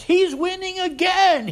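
high, mock-furious outburst, slowed; imitating angry shout. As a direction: act out the accuser losing his temper. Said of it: "He was so angry".

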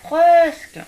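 réponse criée très forte et haute; crie vers le bas. A narrator's reading. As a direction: appel lancé d'en haut. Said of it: "répondit Petit ours mal peigné". (l'ourson crie aux lapins d'en haut)

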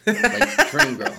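excited squeal. Real, not acted. Hyping up the imagined girl.

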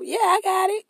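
high, chirpy mimic of an eager yes-man; mock eager yes. Mimicking the friend who always says yes, high and eager. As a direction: squeak it out like a pushover.

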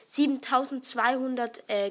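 usual speaking voice

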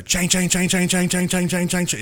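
high repeated ching chime noise; imitated car chime. Imitating the car's chime to a friend, high and repeating. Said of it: "It makes a loud noise like that".

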